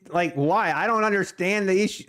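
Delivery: ordinary talk, nothing marked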